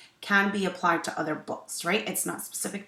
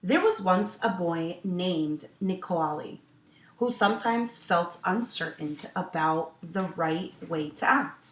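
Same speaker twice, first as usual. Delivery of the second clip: story narration; 2 semitones higher, 5 dB louder than usual. A narrator's reading. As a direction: clear storytelling voice for children. Teacher reads picture book aloud.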